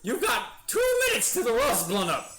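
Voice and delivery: high, very loud villain shout; Frieza. Acted. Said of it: "I don't think I can do a Frieza voice".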